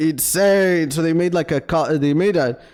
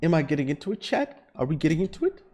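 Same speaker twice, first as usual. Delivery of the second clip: hoarse voice; 5 dB quieter, faster than usual. Real, not acted. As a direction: voice giving out, hoarse. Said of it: "I'm losing my voice".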